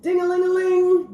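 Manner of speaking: loud, high ringing sound; phone ringing. A narrator's reading. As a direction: ring it out like a bell. Telephone ringing at fire station.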